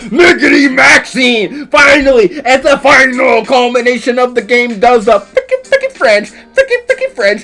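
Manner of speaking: hyped shouting commentator. Acted. Commentator shouts the long-awaited French defense to viewers. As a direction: yell the big reveal to the crowd.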